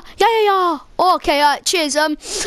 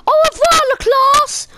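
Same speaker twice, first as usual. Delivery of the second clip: mock French goodbye; 7 semitones higher than usual. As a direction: sing out au revoir to the class. Teacher says goodbye to class.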